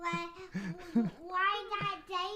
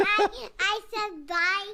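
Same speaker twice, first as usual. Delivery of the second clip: loud insistent; 2 semitones higher, 9 dB louder than usual. Real, not acted. Kid insisting loudly.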